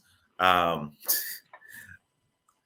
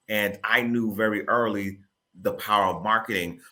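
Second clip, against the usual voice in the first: ordinary delivery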